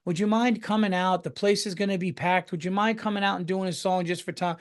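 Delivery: acting like someone begging a favor; mock pleading request. Mimicking people asking a favor.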